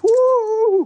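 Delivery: high, loud whoop; excited whoop. Real, not acted. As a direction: cheer him on like a hype man. Hyping up the guest.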